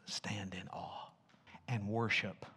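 "stand in awe" in a soft unvoiced whisper. Shown as a demonstration. Pastor whispering the other translation in reverence. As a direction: hush to a breath on "stand in awe". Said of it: "Other translations".

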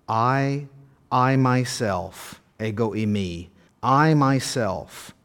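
slow, weighty stress on each I and myself; extra emphasis on I. Shown as a demonstration. Preacher stressing Jesus's words I myself. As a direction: lean hard on I, myself, a slow drawn-out emphasis. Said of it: "extra emphasis".

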